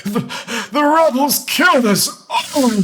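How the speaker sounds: a terrified soldier barely getting the words out; quavering. Acted. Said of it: "he says in a quavering voice".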